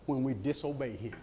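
usual speaking voice

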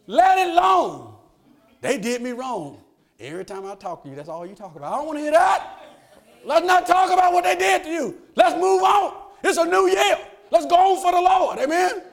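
loud, high preaching push, the congregation rising with him; fiery preaching. Acted. Preacher urging the church to let it go, full-force preaching. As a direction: drive it home like a revival preacher.

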